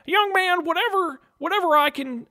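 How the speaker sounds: genie voice mixed up. Acted. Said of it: "I'm mixing up my voices".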